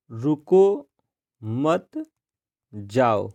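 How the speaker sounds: रुको, मत and जाओ each set apart by long pauses; word-by-word reading. Shown as a demonstration. Teacher dictating the second example sentence, word by word. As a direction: stop after रुको and after मत, drop low on जाओ. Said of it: "अब दूसरे वाक्य है दोस्तों".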